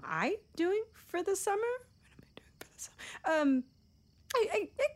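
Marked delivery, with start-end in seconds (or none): 1.6-3.4 whispered high to herself in a panic; panicked whisper. Real, not acted. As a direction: whisper the question back to yourself, pitched high. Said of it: "repeats his question, then whispers it".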